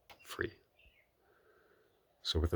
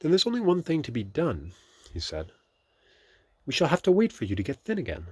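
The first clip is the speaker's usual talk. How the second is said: put-on a higher, earnest boy's voice; Christopher Robin; 8 semitones higher, 5 dB louder than usual. A narrator's reading. Christopher Robin to the stuck bear, earnest. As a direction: reason it out like a sensible boy.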